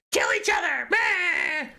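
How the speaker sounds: very high, squawking evil priestess; evil villain shriek. Retold or imitated. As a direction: screech it like a cartoon villainess. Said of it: "she says it evilly".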